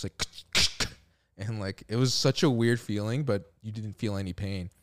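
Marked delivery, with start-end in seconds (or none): 0.2-0.9 sharp unpitched clicking mouth noise; short bursts of staple-gun clicks; staple gun imitation. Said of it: "like, staple gun go on the back".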